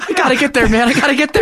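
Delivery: high, pleading, laughing voice; desperate passenger. Retold or imitated. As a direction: beg like a broke passenger. Passenger begging for fare money.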